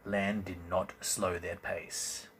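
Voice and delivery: plain reading voice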